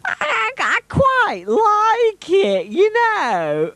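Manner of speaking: very high squeaky put-on voice, drawn out; put-on silly accent. Filmer decides to keep the accent, absurdly high silly voice. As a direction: jump far up and stretch the words. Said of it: "I think I'll keep this accent".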